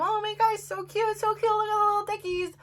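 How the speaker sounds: high-pitched squeal of adoration; cooing delight. Retold or imitated. Videotaping little ducklings for herself, high cooing delight. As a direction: gush over babies in a high coo.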